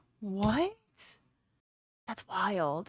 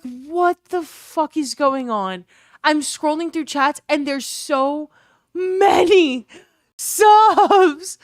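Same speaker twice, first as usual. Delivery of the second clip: overwhelmed; 5 semitones higher, 7 dB louder, much slower than usual. Real, not acted. Overwhelmed, voice breaking.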